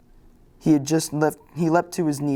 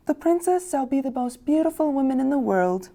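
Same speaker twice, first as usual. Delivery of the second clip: high, light fairy voice, lightly done; a sweet young fairy granting a gift; youngest fairy voice; 11 semitones higher than usual. Acted. Youngest fairy blessing the baby princess, high and light.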